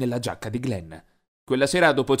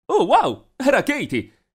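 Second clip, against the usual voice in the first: surprised; 9 semitones higher, 3 dB louder, slower than usual. A narrator's reading. Sees well-dressed girl arrive.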